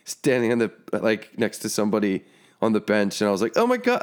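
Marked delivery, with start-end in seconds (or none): 3.6-4.0 surprised. Retold or imitated. Retold shock at seeing player.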